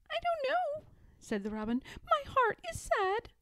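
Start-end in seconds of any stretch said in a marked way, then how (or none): 0.1-0.9 very high, small bird voice; tiny high-pitched sad robin; sad little robin. A narrator's reading. Robin answering Mother Bear, sad and tiny-voiced. 2.0-3.3 very high, small bird voice; tiny high-pitched sad robin; sad little robin. A narrator's reading. Robin answering Mother Bear, sad and tiny-voiced.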